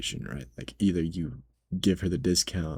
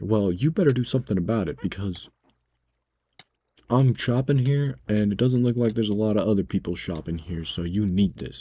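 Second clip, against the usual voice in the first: imitating rude customer; 3 semitones higher, 3 dB louder than usual. Customer demanding the discount, mock entitled. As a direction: lean in like a pushy shopper. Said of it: "she was like".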